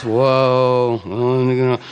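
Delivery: long, drawn-out level whoa; stumped whoa. Acted. King Arthur hears an impossible question, stumped whoa. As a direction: draw it out as if at a loss.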